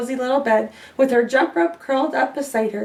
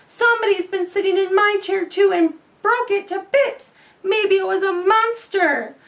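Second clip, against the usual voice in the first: higher small cub voice; little bear voice; 7 semitones higher than usual. Acted. Little Wee Bear sees broken chair, small cub voice. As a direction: make it a tiny wide-eyed cub.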